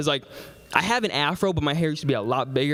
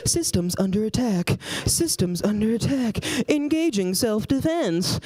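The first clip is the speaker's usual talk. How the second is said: high, automated warning voice; inner alarm system; 6 semitones higher than usual. Retold or imitated. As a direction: announce danger like a ship's computer, high and repetitive. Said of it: "My inner alarm. I was like".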